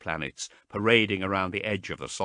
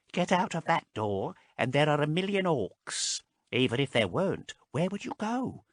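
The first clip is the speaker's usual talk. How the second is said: put-on a lightly raised, thin old voice; Marast's frail voice; 7 semitones higher than usual. A narrator's reading. Marast explaining their slavery to Haas, thin voice. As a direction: speak like a frail small man.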